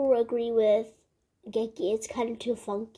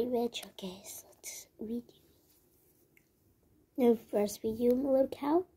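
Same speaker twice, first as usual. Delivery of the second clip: ordinary delivery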